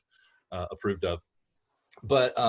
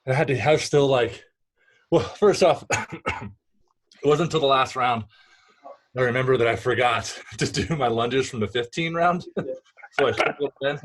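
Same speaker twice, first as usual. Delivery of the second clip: breathless after a workout; out of breath; 4 semitones higher, 6 dB louder than usual. Real, not acted. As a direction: talk while still catching your breath. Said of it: "apologies if I'm catching my breath".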